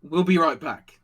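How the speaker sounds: loud acted announcement; soldier calling out. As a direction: call it over to a comrade. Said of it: "He's like".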